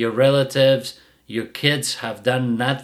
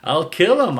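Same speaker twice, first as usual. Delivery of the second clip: a lightly voiced puzzled dog, pitched up; mild mock dog voice, rising questions; dog's voice; 7 semitones higher than usual. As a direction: play the dog taking it as permission. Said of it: "the dog's like".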